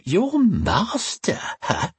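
high, drawn-out contempt; mocking high sneer as the woman; spat scornfully. A narrator's reading. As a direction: spit it back at him, very slowly. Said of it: "she spat".

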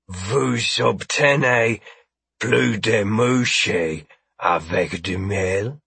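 slow, deliberate French; French at half speed; speaking French. Acted. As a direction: say it in careful, drawn-out French. Character switches into French.

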